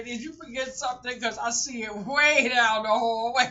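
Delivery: loud, high, laughing holler; mock eager hollering. Host rushing a crying child out, playful high shouting. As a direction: call down the hall in hammed-up haste.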